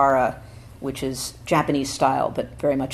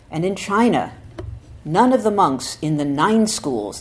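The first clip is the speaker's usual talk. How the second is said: louder, emphatic recitation, rising at the start; solemn scripture reading; 4 dB louder than usual. Acted. Teacher reading Dogen's essay to students, emphatic. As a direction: proclaim the old text with weight.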